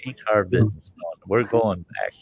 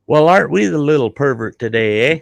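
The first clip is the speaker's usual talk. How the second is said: a mock tart scold, louder; scolding mother; 5 semitones higher, 5 dB louder than usual. Mother scolding her son on the porch, mock tart tone. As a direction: tease him like a sharp old mom. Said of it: "she looks right in his fat face and goes".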